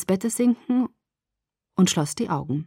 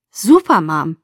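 forced enthusiasm; 3 semitones lower, 6 dB louder, slower than usual. A narrator's reading. Daughter feigning delight for mother, forced enthusiasm. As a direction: cheer as best you can. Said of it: "so enthusiastisch wie möglich".

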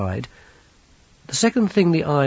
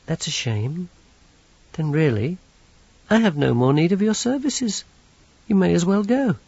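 lightly voiced polite young villain, a touch higher; Teatime's voice; 7 semitones higher than usual. Teatime dismisses the locksmith.

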